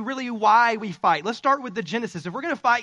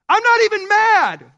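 high, loud yell while insisting he's calm; 10 semitones higher, 10 dB louder than usual. Shown as a demonstration. Pastor showing how he yells at his wife, shouting. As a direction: shout it like you can't help yelling. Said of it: "I yell".

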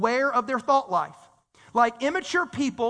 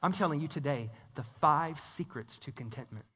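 low, soft teaser pitch; mock-secretive; 9 semitones lower, 8 dB quieter than usual. Preacher jokingly selling his point as clickbait, hushed. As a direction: confide it like a big reveal.

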